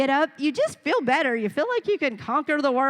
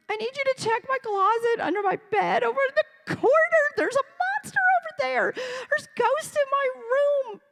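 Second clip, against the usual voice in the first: put-on a scared child begging parents to check; frightened child.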